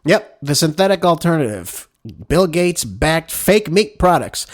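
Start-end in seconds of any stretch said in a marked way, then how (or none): none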